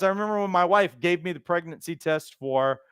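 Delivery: ordinary talk, nothing marked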